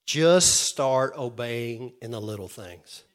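slow, weighty urging; slow and firm pastoral charge; slow emphatic exhortation. Real, not acted. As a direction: lean on each word slowly. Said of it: "I want to just say this".

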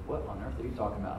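low, hushed, quick aside; mock bewilderment, very soft and low; imagined baffled listener. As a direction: voice a congregant's puzzled thought under the breath. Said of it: "You say".

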